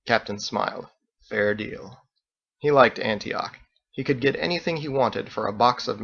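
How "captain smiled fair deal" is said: plain reading voice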